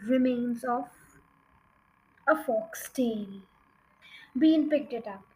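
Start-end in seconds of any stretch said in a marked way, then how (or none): none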